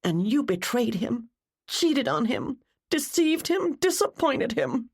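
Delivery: high, sobbing accusation through tears; crying character, high and anguished; tearful outburst. A narrator's reading. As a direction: let grief push the voice way up, faltering at the end. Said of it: "Jennifer burst into tears".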